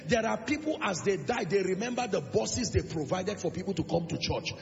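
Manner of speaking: loud, exhorting sermon voice; full-force preaching. Real, not acted. Preacher urging the elderly to finish with honor, full-force preaching. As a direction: drive each charge home with punched key words.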